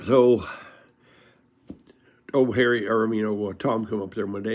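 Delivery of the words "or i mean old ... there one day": ordinary delivery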